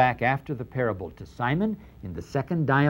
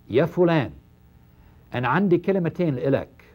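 Arabic phrase; 4 semitones higher than usual. Shown as a demonstration. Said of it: "an idiomatic phrase in our Middle Eastern speech".

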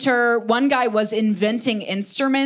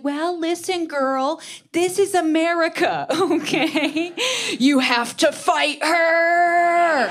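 much higher, laughing, slowing to a drawn-out finish; high, brassy, laughing encouragement; sassy American pep talk; 8 semitones higher, slower than usual. Retold or imitated. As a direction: hype her up to go fight. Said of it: "I'm just like".

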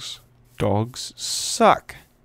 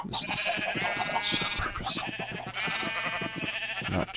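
much higher and softer, playful denial; mock squeaky protest; 13 semitones higher, 9 dB quieter, faster than usual. Player fibbing to chat, squeaky mock voice. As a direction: squeak out a fake excuse. Mock defence after leaving door open.

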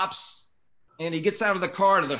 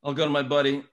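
ordinary delivery

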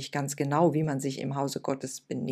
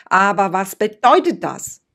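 growing desperation; 4 semitones higher, 12 dB louder than usual. Acted. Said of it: "So langsam rückte die Verzweiflung näher".

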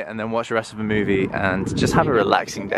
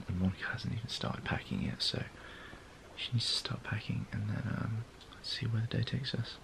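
whisper; 17 dB quieter than usual. Real, not acted. Whispering so Monica won't hear.

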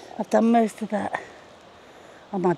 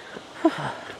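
tired sigh; 6 semitones lower, 7 dB quieter than usual. Real, not acted. Tired sigh on hot climb.

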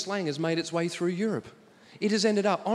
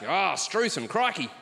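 hammed-up Aussie slang; 3 semitones lower, slower than usual. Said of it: "piling on slang".

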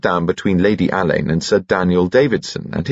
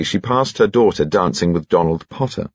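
plain reading voice

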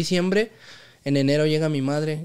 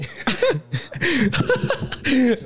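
high helpless laugh; high laughter; 10 semitones higher than usual. Real, not acted. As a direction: keep cracking up. Still laughing. (risa aguda)

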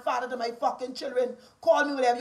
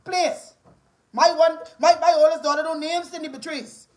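loud, higher, indignant demand; angry warning; 3 semitones higher, 5 dB louder than usual. Real, not acted. Mother telling critics to leave her children out, angry. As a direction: lay down the law forcefully.

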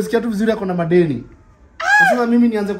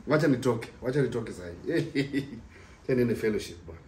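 quiet; 8 semitones lower, 10 dB quieter than usual. Real, not acted. Quietly sneaking out to fellowship.

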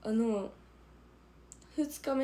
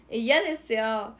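loud refusal; 9 dB louder, slower than usual. Real, not acted. Refusing to make a funny face.

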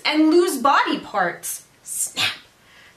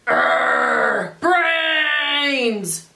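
zombie groan; 4 semitones higher than usual. Acted. The monster answers Jack with a zombie moan. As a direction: groan out brains like a starving zombie. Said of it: "the monster replied".

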